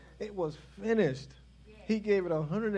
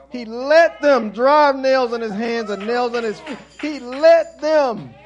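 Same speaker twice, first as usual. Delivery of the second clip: full-force preaching; 5 semitones higher, 12 dB louder than usual. Acted. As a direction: preach it, leaning hard on let. Pastor preaching the crucifixion.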